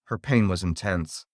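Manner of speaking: plain reading voice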